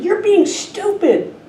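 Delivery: put-on high, slow, indignant kid; angry child's shout. Boy losing patience with the echo, high and slow. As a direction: stretch out the insult like a fed-up child. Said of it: "he finally said".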